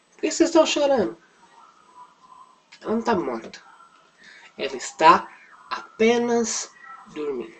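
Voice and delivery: lightly acted, high quick question, then settling; simple matter-of-fact Jesus. Jesus asking the weeping mourners, lightly acted simple manner. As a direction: ask simply, then stretch the answer a little. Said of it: "Bem simples".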